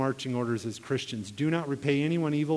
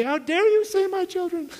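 much higher, louder, hammed-up indignation; mock outraged scream; 5 dB louder than usual. Boy urging Mom to scream at the neighbor, mock outrage. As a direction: play an outraged mother screeching in protest. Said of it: "go up there and scream at him".

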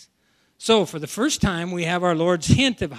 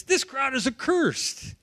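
higher, drawn-out mock condemnation; mildly fierce denunciation, pitched up; firing back, acted; 6 semitones higher, slower than usual. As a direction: act out an indignant retort, slowly. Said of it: "So they're firing back".

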